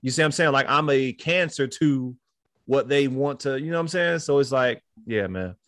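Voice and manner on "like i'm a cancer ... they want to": normal talking voice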